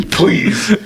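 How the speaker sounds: high, pleading beg; begging. Retold or imitated. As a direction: plead with them not to leave. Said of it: "I'm, like, begging the other missionary".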